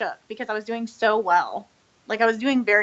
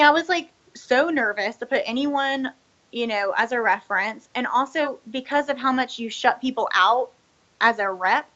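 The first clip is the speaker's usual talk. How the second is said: ordinary delivery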